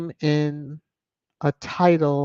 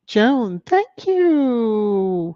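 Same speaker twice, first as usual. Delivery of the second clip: high, sing-out thank-you with stretched vowels; effusive thanks; 8 semitones higher, slower than usual. Real, not acted. Streamer thanking Joan for super sticker, effusive singsong. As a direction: gush it in a high cooing voice. Gushing thanks for a viewer's gift.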